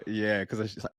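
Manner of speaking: ordinary talk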